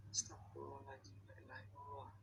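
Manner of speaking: hushed frightened prayer; whispered prayer. Real, not acted. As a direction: pray under your breath. (berdoa sambil berbisik)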